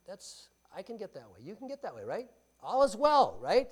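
ordinary delivery

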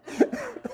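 high squeaky laugh; a delighted little laugh at himself; burst of laughter. Real, not acted. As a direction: let a high giggle escape. Laughing at his old car.

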